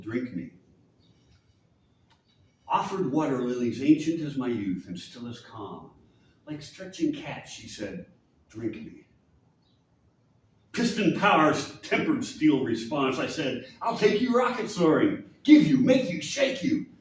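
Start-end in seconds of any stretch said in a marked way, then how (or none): 2.7-13.7 dramatic poem recital, swelling and hushing; performed verse with wide dynamic swings; poetry reading. Acted. Reads his poem aloud. 13.8-16.9 high, mounting poetic vow; urgent, raised recital; fervent boast. Acted. Poem's boastful reply to her.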